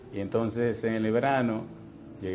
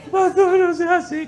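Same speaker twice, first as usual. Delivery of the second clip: very high, slow, laughing whine; high, drawn-out whimpering plea through laughter; pleading man; 7 dB louder than usual. Retold or imitated. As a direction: beg the pastor like a scolded child.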